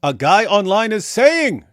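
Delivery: exasperated slow echo; emphatic repeat. Shown as a demonstration. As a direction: hammer the phrase to mock it. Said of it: "think about what you just did there".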